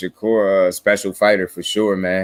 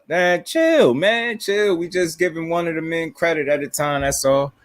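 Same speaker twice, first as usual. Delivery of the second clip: high-pitched, pleading to calm down; half-laughing plea to relax; exasperated chill-out; 8 semitones higher than usual. Retold or imitated. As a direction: wave off the critics, voice climbing high. Answering fans' accusation.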